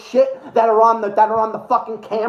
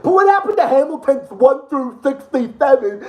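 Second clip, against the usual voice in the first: ordinary talk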